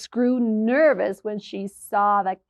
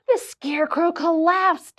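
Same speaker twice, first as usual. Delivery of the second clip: usual speaking voice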